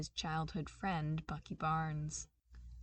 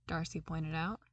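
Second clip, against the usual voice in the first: ordinary delivery, nothing marked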